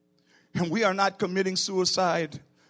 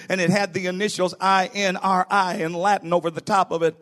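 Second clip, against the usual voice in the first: normal talking voice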